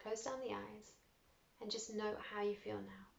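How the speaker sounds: hushed meditation voice; soft meditation close. Acted. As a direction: settle them into stillness. Closing meditation.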